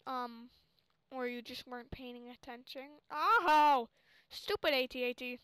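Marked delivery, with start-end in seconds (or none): 3.1-5.4 annoyed. Real, not acted. Frustrated by game enemy.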